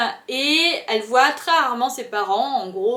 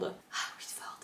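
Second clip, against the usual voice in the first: dreamy whisper; 19 dB quieter than usual. Acted. Swooning over the love interest.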